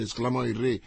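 ordinary delivery

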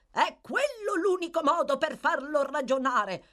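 high, loud calling voice; shouted approval. A narrator's reading. A spectator at an attic window, shouting agreement. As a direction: call out approval from afar. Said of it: "gridò uno spettatore dalla finestra".

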